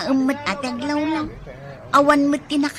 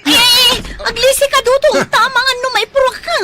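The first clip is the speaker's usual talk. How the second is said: high, loud frantic pleading; frantic high plea to stop fighting; alarmed pleading; 12 semitones higher, 9 dB louder than usual. Acted. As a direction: beg him to stop. Woman tries stopping the fight.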